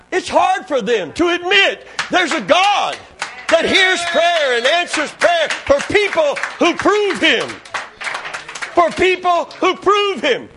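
high, emphatic pulpit cry; full-force preaching. Acted. Preacher urging that God answers prayer, full-force preaching. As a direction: drive each phrase home to the congregation.

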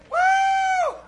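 very high shouted woo; ecstatic whoop. Real, not acted. Preacher whooping at the sermon's peak, a high joyful cry.

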